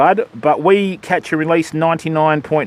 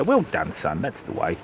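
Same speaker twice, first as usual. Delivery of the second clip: patronizing; 10 semitones lower, 8 dB quieter than usual. Retold or imitated. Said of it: "You go".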